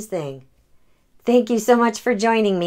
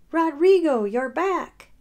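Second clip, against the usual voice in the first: put-on delighted old lady; 9 semitones higher, slower than usual. Old lady thrilled to see her moose again, high. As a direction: call out to a long-lost pet.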